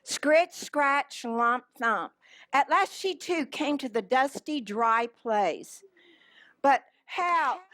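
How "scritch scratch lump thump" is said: ordinary delivery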